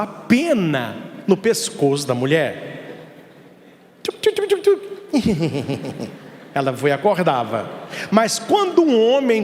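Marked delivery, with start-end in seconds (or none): 4.0-6.1 tickling sound effect. Retold or imitated. Feather tickling sleeping woman.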